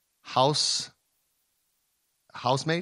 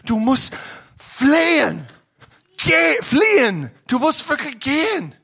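preaching at full force; 11 semitones higher, 7 dB louder than usual. Acted. Preacher urges the listeners to leave, shouting. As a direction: thunder it at the congregation.

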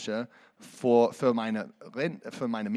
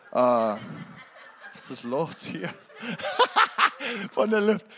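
laughing disbelief; 7 semitones higher, 5 dB louder, slower than usual. Real, not acted. Marvels at money from air.

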